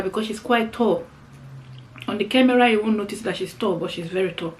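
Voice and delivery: ordinary talk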